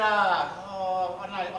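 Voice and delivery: normal talking voice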